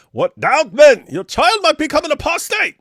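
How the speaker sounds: high, loud sneering read of a message; mock outraged chatter. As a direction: blast it out like an outraged zealot. Reads a chat message in voice.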